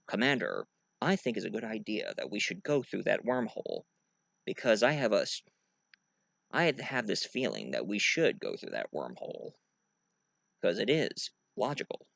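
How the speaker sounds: low, soft, measured officer; subdued, logical deadpan; Spock. Acted. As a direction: reason it out quietly and without emotion. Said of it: "So he said".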